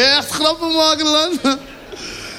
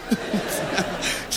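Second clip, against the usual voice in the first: usual speaking voice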